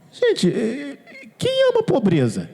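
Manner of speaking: high-pitched, incredulous appeal; incredulous exclamation. Real, not acted. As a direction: ask it in high-voiced disbelief. Incredulous rhetorical question to congregation. (pergunta incrédula, em voz aguda)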